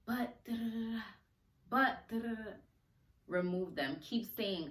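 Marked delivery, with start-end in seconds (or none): 0.1-2.5 a higher, lightly voiced nagging inner critic; mock nagging doubt. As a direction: tease the doubting voice as babble, slightly high. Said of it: "negative thoughts that wanna creep up and say".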